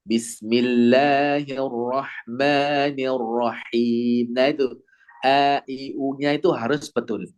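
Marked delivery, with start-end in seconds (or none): 0.0-4.3 very slow bismillahirrahmanirrahim with wide grin; jaw open, grinning. Shown as a demonstration. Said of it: "dibuka rahangnya sambil meringis".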